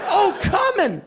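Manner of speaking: high, pushed-out cry; orgasmic exclamation. Shown as a demonstration. Man insists what he really cried out, high orgasmic exclamation. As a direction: exclaim it as if climaxing. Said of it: "I said".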